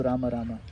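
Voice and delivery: "Rama" as two syllables, ending in a soft a; full ma. Shown as a demonstration. Teacher showing how they chant, both syllables sounded. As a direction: keep the final vowel on each "Rama". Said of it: "you hear me chant".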